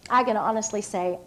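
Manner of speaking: ordinary talk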